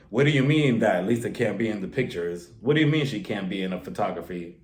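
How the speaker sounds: low, mock-indignant husband voice; John. Husband confronting the photographer for his wife, mock pissed. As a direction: drop the pitch and puff up in mock offence. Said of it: "he was a little pissed".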